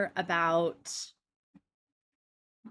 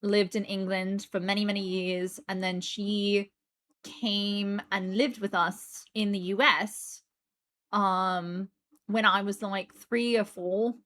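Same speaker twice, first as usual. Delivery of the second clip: lightly put-on British accent; amateur British accent played up on purpose; fake British accent. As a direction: talk in a self-aware, exaggerated British accent. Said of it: "doesn't always sound so put upon as this".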